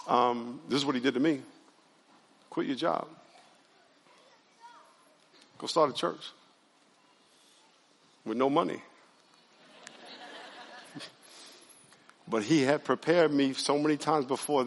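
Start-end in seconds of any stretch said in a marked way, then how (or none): 2.5-8.8 very soft imitation of God's voice; quiet divine prompting. God telling the preacher to start a church, softly imitated. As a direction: deliver it hushed, with long pauses between phrases. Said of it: "he's gonna say this".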